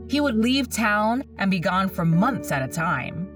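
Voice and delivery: ordinary delivery, nothing marked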